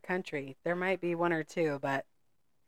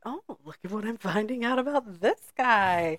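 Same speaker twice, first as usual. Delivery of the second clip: mock fascination; 6 semitones higher than usual. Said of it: "I'm like".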